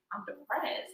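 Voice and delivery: soft, deflated admission; flat sad. Retold or imitated. To herself on a heavy Monday, soft and deflated. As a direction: let it drop out quietly. Retelling her low Monday.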